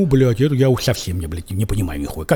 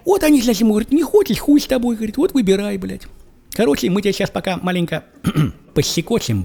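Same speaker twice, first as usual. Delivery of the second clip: ordinary delivery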